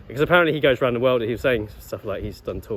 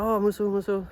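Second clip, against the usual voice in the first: much higher, bright recognition; old man imitation; 11 semitones higher than usual. Old man recognising the martial art, high imitated voice. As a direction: echo the word in his high voice.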